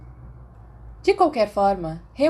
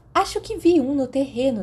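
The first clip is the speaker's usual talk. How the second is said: 1 higher student voice; 7 semitones higher than usual. A narrator's reading. A student claims a sighting.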